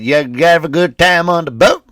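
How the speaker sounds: hammed-up Southern good old boy; Southern US accent. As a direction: put on a big Southern US accent. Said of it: "he sounded just like that".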